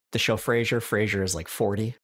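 ordinary delivery

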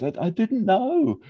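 very high, slow, timid mock voice; high sheepish Sean. Sean stammering to Liz that he didn't know. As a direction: bleat it out guiltily. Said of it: "And he goes".

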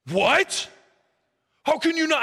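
incredulous, high-pitched outburst; retold indignant 'what?!', jumping up high; agitated disbelief. Agitated man shocked the preacher disagrees, imitated incredulous outburst. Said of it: "start getting agitated".

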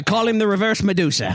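high-pitched NPC voice; a high squeaky voice for the NPC; high character voice. Acted.

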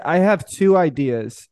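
normal talking voice

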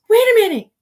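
loud, high-pitched imitation of an excited girl; mock excited interjection; sudden interruption. Other girl interrupts, acted quote.

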